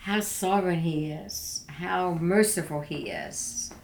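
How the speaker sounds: ordinary talk, nothing marked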